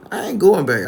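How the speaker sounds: loud, high protest; acted panicky objection; alarmed refusal.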